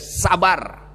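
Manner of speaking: usual speaking voice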